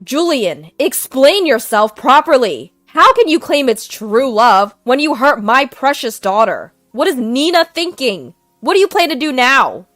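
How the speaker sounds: loud furious demand; father furious at son-in-law, loud. Acted. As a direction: rage at him. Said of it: "My dad was openly furious".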